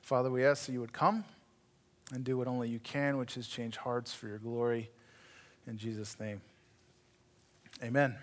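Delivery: soft, low prayerful voice. Real, not acted. Pastor closing a prayer before the congregation, hushed and reverent. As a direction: pray quietly and reverently.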